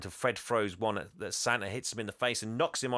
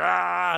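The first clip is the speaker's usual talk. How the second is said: angry yell; 8 semitones higher, 10 dB louder than usual. Retold or imitated. Santa jumping in rage.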